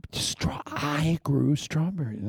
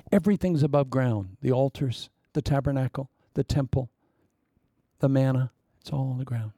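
usual speaking voice

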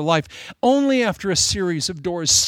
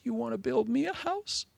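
soft, gentle, softer and higher-pitched loving reply; tender voice of God; 4 semitones higher, 4 dB quieter than usual. Acted. God gently answering David's wish to build, tenderly. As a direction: speak to him like a father comforting a child. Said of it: "God so tenderly says".

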